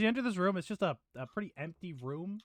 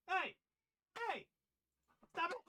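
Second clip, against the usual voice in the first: yelling stop; 5 dB quieter than usual. Real, not acted. Yelling at transformer.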